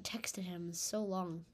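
a guilty little whisper; whispered aside. Real, not acted. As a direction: breathe it out as an aside. Guilty aside about Elias.

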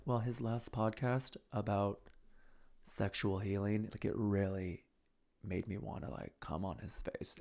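quiet, slow, dreamy mock voice; soft dreamy mock musing. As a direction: muse softly, lost in thought. Imitating a woman's musing.

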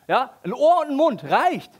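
high, loud mock dismissive; mock stingy creator. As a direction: play a stingy maker. (hoch und laut, spöttisch geizig)

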